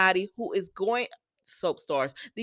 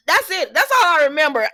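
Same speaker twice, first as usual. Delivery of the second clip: excited outburst; 5 semitones higher, 8 dB louder than usual. Real, not acted. Abrupt end of recap.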